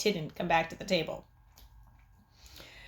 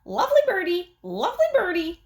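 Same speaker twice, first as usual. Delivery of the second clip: high, louder bird voice; bird mimicking; 10 semitones higher, 5 dB louder than usual. A narrator's reading. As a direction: parrot it like a mynah. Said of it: "Uncle Feather said".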